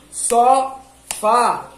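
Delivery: "Sofá" said in two separated syllables. Shown as a demonstration. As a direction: clap "Sofá" in two beats. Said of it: "enquanto você tá falando as sílabas".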